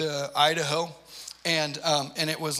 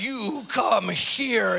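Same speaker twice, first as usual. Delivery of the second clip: higher, louder, drawn-out threat; menacing slow command; 6 semitones higher, 4 dB louder, much slower than usual. Retold or imitated. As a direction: stretch every word like a warning. Dad reaching back at son.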